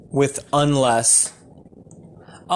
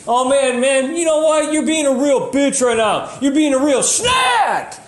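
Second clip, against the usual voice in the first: mock insult; 11 semitones higher than usual. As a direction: play an exasperated friend telling someone off. Acted insult quote.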